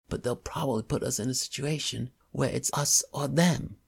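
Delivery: a touch of working-class English; young man, slight English accent. Acted. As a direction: voice the jittery young crook. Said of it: "slight working-class English accent".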